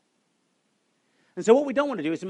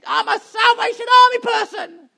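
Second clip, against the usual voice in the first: put-on high-pitched, loud old lady voice; little old lady; 9 dB louder than usual. Old lady answering the preacher, high-pitched and proud. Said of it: "don't try and do high-pitched voices".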